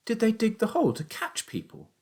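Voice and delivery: put-on high boyish question; incredulous young son. A narrator's reading. Son asks father about the trap, incredulous. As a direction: ask high, in a child's voice.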